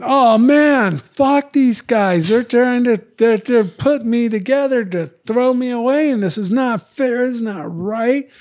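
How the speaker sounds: high, loud, indignant rant at the lawyer; retold angry outburst. Telling Jerry off about the unfair deal, loud and indignant. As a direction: vent it like you've been wronged, voice up high and loud. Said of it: "I yell at Jerry. I didn't yell".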